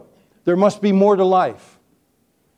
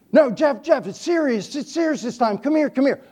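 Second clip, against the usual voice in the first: much higher, pleading mock seriousness; mock urgent uncle; 8 semitones higher than usual. Teasing uncle pretends it is serious, hammed-up urgency. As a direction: fake alarm to fool the boy.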